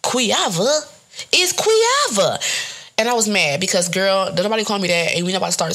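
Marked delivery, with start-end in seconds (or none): 0.0-2.4 mock outrage. Mocks the Hawaiian version of her name.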